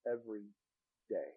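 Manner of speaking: soft, low, hushed voice of God; the quiet inner voice of God speaking to the preacher, softly; still small voice. Retold or imitated. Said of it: "the still, small voice".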